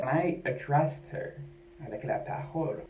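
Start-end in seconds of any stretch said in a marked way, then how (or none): 1.9-2.8 quiet French in a poor accent; French said softly with a clumsy accent; bad French accent. Real, not acted. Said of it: "That was a terrible accent".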